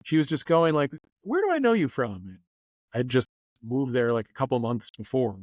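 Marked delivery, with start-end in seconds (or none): 1.2-2.2 1 mimicking curious stranger. Stranger in line asks.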